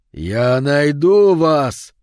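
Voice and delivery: loud, very drawn-out enraged cry; howl of helpless rage. A narrator's reading. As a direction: wail the threat out long and loud. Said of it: "вопль беспомощной ярости". (протяжный вопль бессильной ярости)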